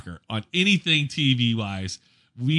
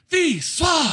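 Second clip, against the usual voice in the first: high-pitched chanting shout; imitated screaming crowd. French fans screaming at the match, high imitated shout. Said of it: "the French are out screaming".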